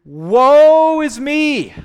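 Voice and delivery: long, high, loud, slow cry of "Woe is me"; anguished wail. Retold or imitated. Isaiah before God's holiness, long anguished wail. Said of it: "the first response that he has is".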